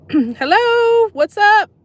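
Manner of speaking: ordinary talk, nothing marked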